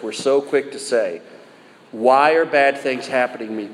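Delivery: normal talking voice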